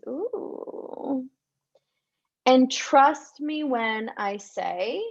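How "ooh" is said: dreamy ooh. Shown as a demonstration. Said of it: "walking around the world like this".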